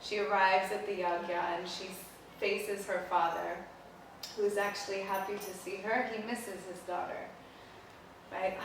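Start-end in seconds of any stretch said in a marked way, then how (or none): none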